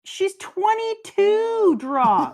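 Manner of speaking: drawn-out, high exasperated yell; exasperated shout. Real, not acted. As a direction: stretch it out, loud and fed up. Exasperated retort to friend.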